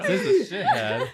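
mocking squeak. Real, not acted. Sibling insult, squeaky taunt.

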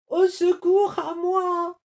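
high, loud, crying panic; wolf crying for help from a shark; frightened cry for help. A narrator's reading. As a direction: cry out in terror. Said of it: "cria Lou".

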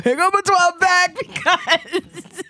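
high, drawn-out excited holler; urgent retold plea. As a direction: mock-desperate shout down the phone. Retold urgent call to sister.

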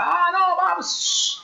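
a podcaster acting out Luke's shocked disbelief; mock stunned gasp. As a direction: squeal it like a hero hit by the twist. Luke's stunned reaction, retold.

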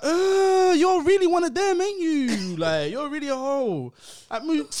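high mocking retort; mocking scorn. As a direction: sneer it at her, pitched high. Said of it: "and I was like".

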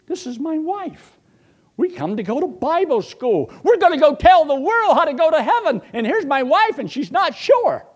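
high, loud, strained disbelief; incredulous exclaim. Retold or imitated. Preacher recalling his shock at his wife, high and loud. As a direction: voice cracking up high in alarm.

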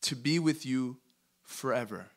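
measured verse reading, falling at the end; slow reading. Shown as a demonstration. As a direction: read it slowly, phrase by phrase. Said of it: "read it really slow".

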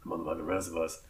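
loud opening, slowing to a drawn-out finish; show announcer intro. Acted. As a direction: belt out the podcast welcome, hammed-up. Hammed-up show intro after interruption.

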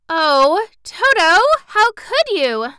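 high, loud, slowing dismayed plea; a girl shocked and hurt by her pet; distressed. Acted. Dorothy scolds her dog Toto, high and distressed. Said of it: "exclaimed Dorothy, much distressed".

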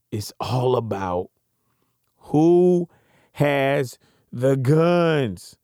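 all about WHO has the GUNS, who punched, guns slow; slow emphatic refrain. Shown as a demonstration. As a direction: stress WHO and draw out GUNS. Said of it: "I want you to go back".

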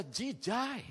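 slow, restrained disgust on "jijai"; retold disgust. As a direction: stretch "jijai" slowly in distaste. Voices disgust at the poster.

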